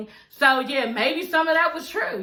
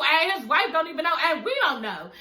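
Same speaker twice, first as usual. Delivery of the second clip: ordinary talk, nothing marked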